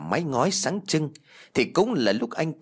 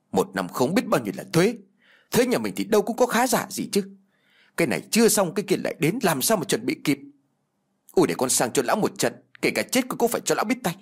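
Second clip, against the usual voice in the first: high, indignant rant, hurried in places; shrill angry outcry; 7 semitones higher, faster than usual. A narrator's reading. Son vowing to confront the landlord, high-pitched indignant outburst. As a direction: rail in a raised pitch, building to a threat. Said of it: "rít lên những tiếng kêu inh ỏi".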